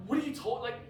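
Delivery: mock stern rebuke. Leader Joe telling him off, in a loud mock scolding. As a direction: scold him like a friend who's had enough. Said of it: "he goes".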